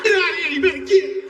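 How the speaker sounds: high-pitched mock chasing-off; shooing yell. Comedian treats people like cats, high shooing yell. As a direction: shoo them off like stray cats.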